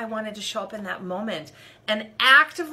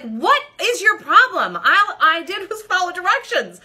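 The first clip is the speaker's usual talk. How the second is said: much higher and louder, exasperated outburst; lashing out; 10 semitones higher, 9 dB louder than usual. Retold or imitated. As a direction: let the frustration blow up at her. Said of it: "I wanted to lash out".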